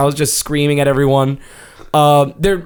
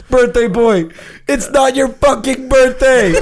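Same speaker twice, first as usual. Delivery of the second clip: high, mock-furious yell; hammed-up exasperated challenge; wrestler's angry taunt; 8 semitones higher than usual. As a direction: imitate a wrestler telling off a rival. Rival wrestler taunts birthday boy.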